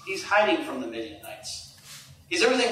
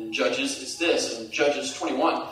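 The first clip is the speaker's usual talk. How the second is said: ordinary delivery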